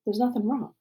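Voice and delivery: ordinary delivery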